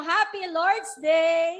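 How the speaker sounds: big slow church cheer; loud greeting. Shown as a demonstration. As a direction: call it out slowly to the neighbour. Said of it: "Sa kabila, sabihin mo".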